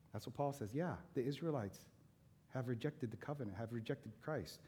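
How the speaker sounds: ordinary delivery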